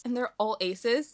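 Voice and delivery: ordinary delivery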